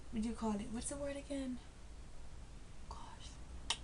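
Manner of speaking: half-whispered, searching for the word; trailing off into a whispered gosh; muttered aside to self. Real, not acted. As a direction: mutter it under your breath while thinking.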